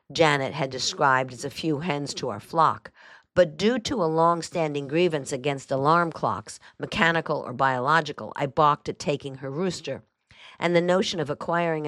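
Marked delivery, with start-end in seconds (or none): none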